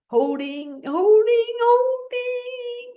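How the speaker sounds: high, strained holding, holding; held breath. Shown as a demonstration. Teacher counts the breath hold, pitch climbing. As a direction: squeeze it out while holding the breath. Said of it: "breathing in again".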